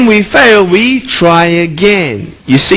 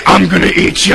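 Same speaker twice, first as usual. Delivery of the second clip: mock monster. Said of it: "running after her saying".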